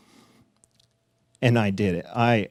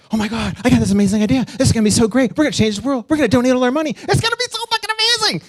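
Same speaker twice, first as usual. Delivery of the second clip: much higher, louder, fast gushing; hammed-up giddy rush of excitement; over-excited; 13 semitones higher, 5 dB louder, much faster than usual. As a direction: bubble over with wild excitement. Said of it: "I was so excited".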